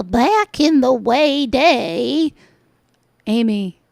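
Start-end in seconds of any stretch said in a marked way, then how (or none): 0.0-2.3 slow drawn-out copy of her phrase; a light mock of her twang; mocking Amy's drawl. Mocking the vlogger's odd way of talking, slow drawl. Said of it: "why do you talk like that?".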